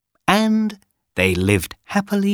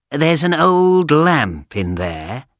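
sly villain voice; 6 semitones higher, slower than usual. Acted. Wicked magician voiced.

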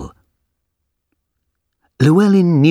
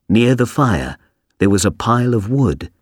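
ordinary delivery, nothing marked

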